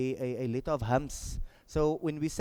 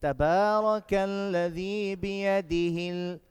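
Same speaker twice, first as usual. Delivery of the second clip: slow, sustained chanted verse; measured, melodic tajweed delivery; Quran recitation; 4 semitones higher, much slower than usual. Shown as a demonstration. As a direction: recite the phrase in one long breath with drawn vowels. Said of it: "three times from the beginning".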